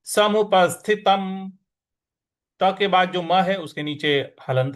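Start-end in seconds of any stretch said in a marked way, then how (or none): 0.0-1.5 समुपस्थितं slow, weight on the स before थ; stress demo. Shown as a demonstration. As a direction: lean into the स of समुपस्थितं. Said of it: "उस पर हमको आघात देना है".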